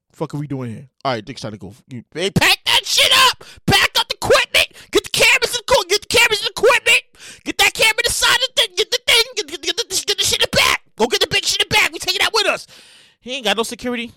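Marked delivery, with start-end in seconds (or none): 1.9-12.7 hammed-up crew boss yelling at the team; mock shouting orders to grab the equipment; barked orders. Acting out crew leaving.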